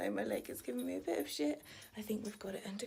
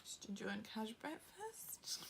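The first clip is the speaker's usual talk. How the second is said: normal talking voice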